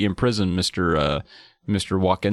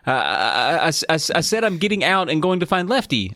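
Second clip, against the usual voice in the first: quick, higher-pitched stammered cover-up; acted scrambling for words; flustered stammer; 5 semitones higher than usual. A narrator's reading. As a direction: stumble into a hasty excuse. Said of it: "thinking quickly".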